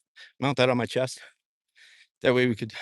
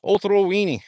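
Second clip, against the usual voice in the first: mock Spanish; 7 semitones higher, 6 dB louder than usual. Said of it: "I almost said".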